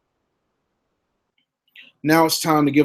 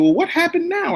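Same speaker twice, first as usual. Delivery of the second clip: grandmother sighing. Retold or imitated. Grandmother voiced in story.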